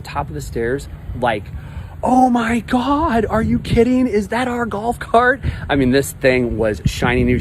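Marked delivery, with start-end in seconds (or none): 2.0-5.4 high, slow and drawn out in disbelief; the woman can't believe her eyes; delighted shock, drawn out high; amazed gasp. Retold or imitated. Woman amazed at cleaned cart.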